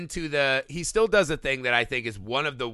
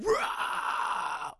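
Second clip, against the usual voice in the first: mock shout; 4 dB quieter than usual. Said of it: "she just starts going".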